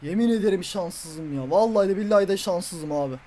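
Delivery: low, quiet, deflated complaint; dejected lament. Real, not acted. Gamer swearing he is unlucky, dejected lament. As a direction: let the voice sink low in defeat. Laments bad luck after miss.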